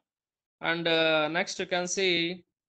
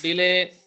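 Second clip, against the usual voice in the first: Delay with లే hit loud; stress on LAY; 7 dB louder, much slower than usual. Shown as a demonstration. Teacher showing students the stress in delay. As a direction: punch the లే of delay. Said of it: "దగ్గర stress mark చేయొచ్చు".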